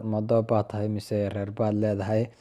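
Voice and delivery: ordinary delivery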